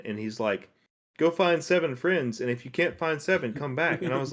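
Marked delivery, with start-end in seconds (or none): 1.2-4.0 higher, lightly voiced old quest-giver; million-year-old man. Million-year-old man sending the player off for friends, lightly imitated higher. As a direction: lightly imitate the old man's instruction, pitched up. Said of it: "to the million-year-old man".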